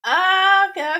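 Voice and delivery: high, loud burst of amazement; sudden delighted okay; excited okay. Real, not acted. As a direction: blurt it out, wowed. Reacting to cat witch lore.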